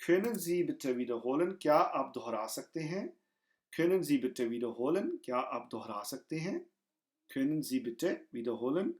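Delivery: ordinary delivery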